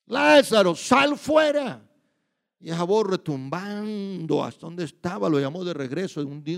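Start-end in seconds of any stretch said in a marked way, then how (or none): 0.1-1.9 Lázaro called out high and loud, sal fuera drawn out slowly; commanding call. Acted. Preacher voicing Jesus calling Lázaro out of the tomb, loud slow command. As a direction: summon a dead man from the tomb at full voice. Jesus calls dead Lazarus out.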